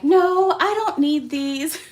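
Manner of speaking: high, loud, drawn-out protest; hammed-up self-denial, imitating her earlier resolve; mock refusal. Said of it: "I was like".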